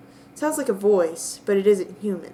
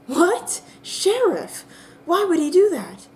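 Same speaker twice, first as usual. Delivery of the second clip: much higher, startled questions; alarmed surprise; 9 semitones higher than usual. Acted. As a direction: caught off guard by talk of the sheriff. Player character reacts in alarm.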